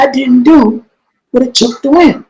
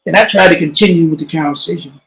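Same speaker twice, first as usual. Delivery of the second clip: ordinary talk, nothing marked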